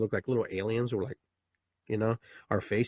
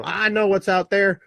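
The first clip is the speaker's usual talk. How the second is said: higher, louder boasting; know-it-all baby; 8 semitones higher, 7 dB louder than usual. Retold or imitated. Baby in the womb bragging to another baby, higher and louder. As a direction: brag to your twin. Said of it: "Talking to other baby in the other womb".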